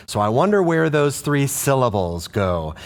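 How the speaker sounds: usual speaking voice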